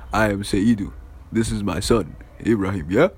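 lightly voiced father introducing himself; a hint of a formal dad voice; slightly put-on dad making introductions; dad's introduction.

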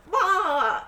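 high, loud "wah" with a laugh; mock death cry. Guy plays along with finger gun, mock death cry. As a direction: flail as if shot, hammed-up and laughing. Imitates man pretending to be shot.

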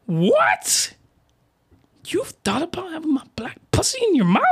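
high, incredulous question; mock astonishment. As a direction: ask it in hammed-up disbelief. Character astonished by the confession.

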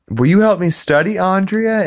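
put-on squeaky teen girl voice, way up high; high-pitched girl. Girl in the diner booth asking a friend for help, high and sweet. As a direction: pipe it up like a young girl asking a favor. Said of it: "then she says".

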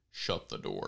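a low order through a speaker; low dog collar voice. Acted.

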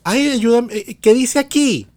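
high, sing-song Maracaibo accent imitating mom. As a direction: put on mom's Maracaibo lilt. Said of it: "mi mamá le dice".

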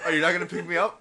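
loud, high mock-indignant character; voicing the drink. Co-host speaks as the neglected spill, mock indignant. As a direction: play an offended object, high and loud.

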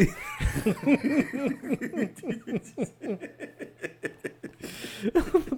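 a long, high, rising burst of laughter; a high laugh that keeps going. Real, not acted. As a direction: laugh freely, climbing up. Laughs on return.

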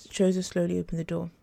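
plain reading voice

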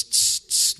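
hissing tss of a spray bottle; spray bottle noise. Retold or imitated. Preacher mimics Windex spray for congregation, hissing spritz.

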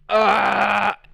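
loud, high retching noise; big mock heave; imitated vomiting. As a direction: mimic a sudden violent puke. Imitates the girl throwing up.

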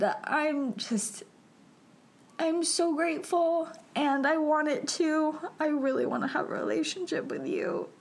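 high, choked-up outpouring; retold heartfelt declaration, overcome; emotional confession. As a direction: pour it out high, on the edge of tears. Retold emotional declaration while crying.

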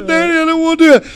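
high, loud imitation of a kid screaming; screaming child. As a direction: mimic a frightened boy protesting to Daddy. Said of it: "he goes to screaming".

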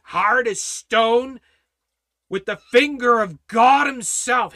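emphatic declaration, climbing in pitch and volume; full-force preaching. Real, not acted. Preacher proclaiming God's word cannot be undone, full-force preaching. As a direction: hammer out each clause like a preacher.

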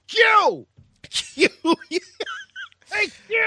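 high laughing yell of the name Q; laughing yell through a car window. Retold or imitated. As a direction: shout out the window, cracking up.

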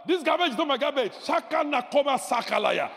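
loud, high shouted rebuke of the bags; commanding shout. Pretend. Preacher shouting at the garbage left by his door. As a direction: bark it out like a command. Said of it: "go outside and say".

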